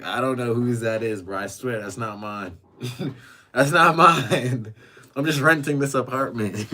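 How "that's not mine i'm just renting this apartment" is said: laughing protest. Real, not acted. Streamer swears to chat it is not his, laughing protest. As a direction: protest your innocence with a laugh. Laughingly denies owning Hello Kitty item.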